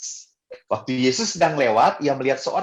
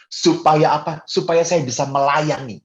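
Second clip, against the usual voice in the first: normal talking voice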